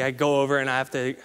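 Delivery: ordinary talk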